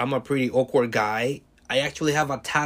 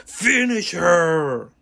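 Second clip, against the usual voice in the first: slow, drawn-out, louder battle cry; dramatic finishing call; 5 semitones higher, 5 dB louder, much slower than usual. Retold or imitated. Devil on his shoulder ending the fight, slow booming command. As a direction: call it like a fighting-game announcer. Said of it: "a little devil appeared next to me and was like".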